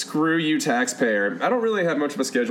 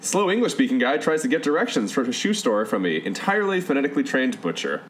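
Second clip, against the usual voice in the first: ordinary talk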